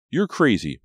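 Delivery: high, loud disbelieving cry; alarmed cry. A narrator's reading. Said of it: "Brady cried".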